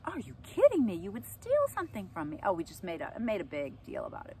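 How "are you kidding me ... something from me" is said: high, loud, disbelieving scold; outraged mom. Retold or imitated. Mom confronting son about stolen money, outraged. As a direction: let the shock burst out at him.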